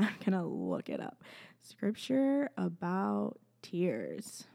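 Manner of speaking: slow, drawn-out talking to yourself; half-aloud, spaced-out search words; absent-minded search murmur. Real, not acted. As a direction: stretch the words while typing a search. Talks to self while searching.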